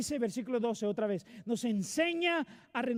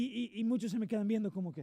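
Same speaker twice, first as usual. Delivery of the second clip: ordinary delivery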